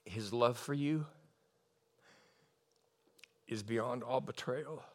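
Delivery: low, hushed, intimate reassurance; quiet counsel, close to tears; tender personal ministry. Real, not acted. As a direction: speak softly and gently, with long pauses. Ministers softly to one woman.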